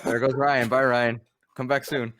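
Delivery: normal talking voice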